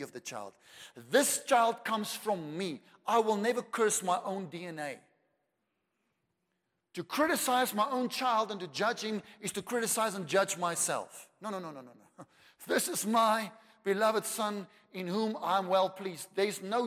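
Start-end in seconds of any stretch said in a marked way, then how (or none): 0.9-5.0 proud father. Retold or imitated. Father declaring the child is his own. 12.7-16.3 loud, higher proclamation; voice of God. Retold or imitated. Father declaring love over his Son. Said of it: "A voice came and said".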